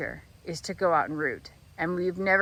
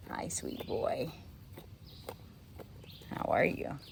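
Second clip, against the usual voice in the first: tender whisper; 6 semitones lower, 9 dB quieter, slower than usual. Real, not acted. Farmer greets the animal she saved in a tender whisper. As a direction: breathe it gently to the little one.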